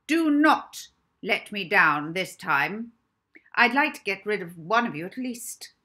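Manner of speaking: loud, high, haughty headmistress voice; stern matron. Acted. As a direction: boom it down at the children. Stern matron addresses orphans.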